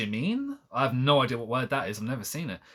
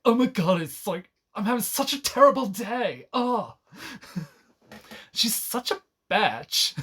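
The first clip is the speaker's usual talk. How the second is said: high, mocking teen-diary voice; mock diary gushing; 9 semitones higher than usual. Streamer mocking the book's heroine in a high whiny voice. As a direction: ham up a whiny diary entry. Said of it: "almost like a diary entry of like".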